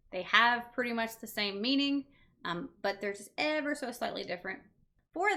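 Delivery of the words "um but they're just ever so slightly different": ordinary delivery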